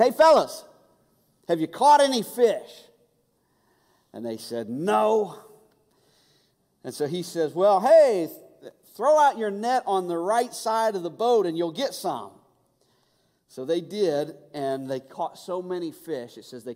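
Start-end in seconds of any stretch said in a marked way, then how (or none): 0.0-2.9 loud, high call across the water. Acted. Jesus calling to the fishermen from the beach, loud and high. 4.7-5.4 called answer. Acted. Disciples answer from the boat. 7.5-12.3 high, loud shouted advice; calling out. Acted. Jesus calling to the disciples in the boat, loud and high.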